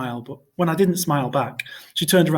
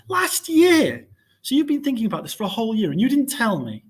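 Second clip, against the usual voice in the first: upset; 9 semitones higher than usual. A narrator's reading. Boy upset at parents' secret.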